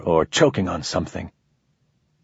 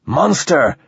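raised call high and loud; 7 semitones higher, 3 dB louder than usual. A narrator's reading. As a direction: call out to the alien. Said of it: "he called".

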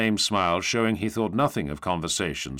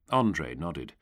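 ordinary delivery, nothing marked

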